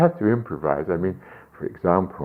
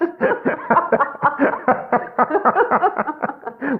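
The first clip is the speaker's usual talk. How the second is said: a burst of high, helpless laughter; giggling high and free; high delighted laugh; 4 dB louder than usual. Real, not acted. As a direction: laugh out loud at the joke. Laughs at own recipe.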